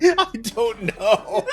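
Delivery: high, slow, through laughter; giggling it out; laughing. Real, not acted.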